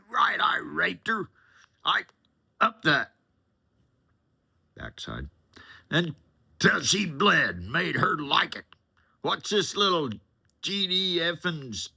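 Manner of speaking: high, loud ranting yell into the phone; furious phone rant. Retold or imitated. Passenger screaming at Jeff on his cell phone, furious high yell. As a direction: tear into Jeff like you want to hurt him.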